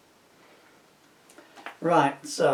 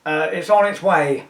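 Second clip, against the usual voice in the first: nervous stammer, louder; 4 semitones higher, 7 dB louder than usual. A narrator's reading. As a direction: stumble out a nervous excuse. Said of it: "Max stammers".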